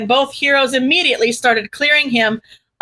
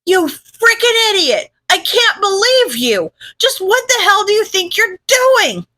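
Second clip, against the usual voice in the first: angry yelling; 8 semitones higher than usual. Acted. Yelling at friend in anger.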